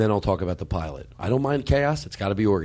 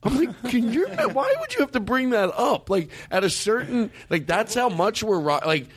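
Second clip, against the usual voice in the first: much higher, exasperated disbelief; incredulous; 9 semitones higher than usual. Real, not acted. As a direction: rant in high-pitched disbelief. Incredulous rant about pilot.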